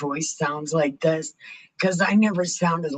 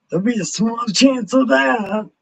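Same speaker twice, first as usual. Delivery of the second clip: high, loud mocking retort; hammed-up singsong mockery; mocking echo; 7 semitones higher, 6 dB louder than usual. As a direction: parody the doctor, pitched up and loud. Mocking the doctor's phrase again.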